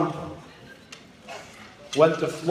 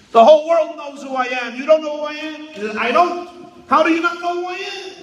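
indignant boasting; 7 semitones higher, faster than usual. Retold or imitated. Trump demanding an old woman recognize him, indignant and boastful.

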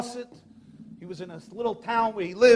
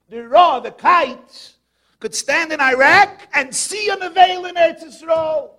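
rising shout; 4 semitones higher, 8 dB louder than usual. Real, not acted. Preacher calling out to his listeners, loud shout. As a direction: build to a preacher's cry.

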